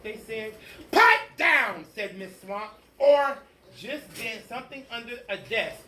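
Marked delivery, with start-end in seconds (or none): 0.9-1.8 much higher, louder bark; stern order. Acted. Strict teacher shouting.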